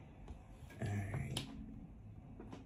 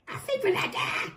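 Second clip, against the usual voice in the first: high, strained combative retort; hammed-up defiant comeback; mock combative; 7 semitones higher, 4 dB quieter than usual. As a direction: throw it back as a high-pitched outburst. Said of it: "That was the combative energy that I heard".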